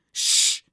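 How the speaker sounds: loud, sharp shush. A narrator's reading. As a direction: hush them forcefully. Hushing others during the film.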